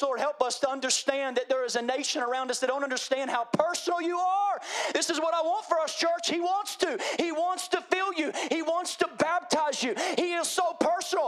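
high, loud, impassioned sermon climax; full-force preaching. Acted. Pastor crying out to the church at full force. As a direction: preach it with rising fire, shouting the prayer.

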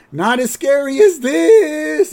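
high and loud, last word stretched out; mock scary shout. Ghost sending the coaster down, hammed-up gleeful shout. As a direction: wind up into a long ride-drop yell. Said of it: "she turns around and she says".

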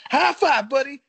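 high, loud hammed-up cheer; high, loud and falsely chummy; mock cheerful shout. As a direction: belt it like a fake-friendly wrestler.

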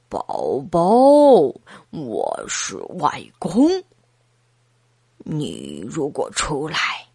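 put-on low, softened old granddad coaxing; grandfather. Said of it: "崔西太太的爸爸来了".

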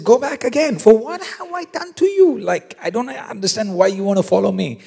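very high, incredulous outburst; shocked. Acted. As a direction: protest in high-pitched, hammed-up bewilderment. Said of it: "that's why he's shocked".

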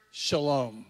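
low, soft, unhurried voice; hushed, low greeting of peace; calm greeting. Acted. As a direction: say it gently and low, falling to rest. Voices risen Jesus greeting disciples.

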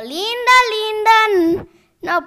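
high loud excited squeal; proud squeal. Real, not acted. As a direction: show off gleefully. La niña presume. (chillido orgulloso)

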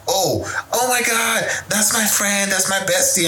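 fake sweet gushing. Passive-aggressive friend mocked gushing about her bestie. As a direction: gush over the bestie, sugary and fake. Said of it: "passive aggressive, like".

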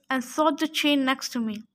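ordinary delivery, nothing marked

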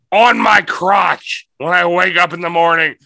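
usual speaking voice

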